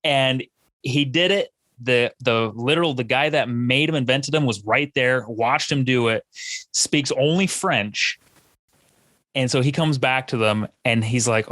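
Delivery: ordinary talk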